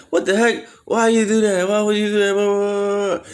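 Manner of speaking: put-on high, loud, indignant drunk protest; drunk mouthing off. Drunk teen yelling at his uncle from the shower, high and loud. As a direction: squeal it like a drunk kid outraged. Drunk him talking back.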